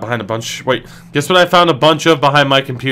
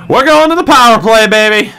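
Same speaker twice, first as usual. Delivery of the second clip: hyped shout; 8 semitones higher, 5 dB louder than usual. Real, not acted. Team gets power play.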